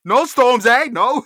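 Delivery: loud, high burst of mock British. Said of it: "That was my best British impression".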